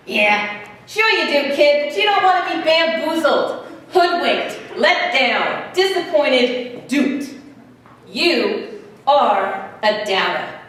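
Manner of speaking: put-on jab each word at the kid, slowing to the end; hobo impersonation. Hobo scoffing at a doubting boy, punchy impersonation. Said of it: "I have a hobo impersonation".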